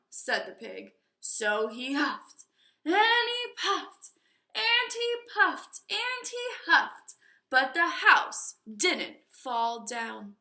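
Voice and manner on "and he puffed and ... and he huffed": storyteller's rising huff; building drama. A narrator's reading. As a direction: swell the tension. Narrating the huffing.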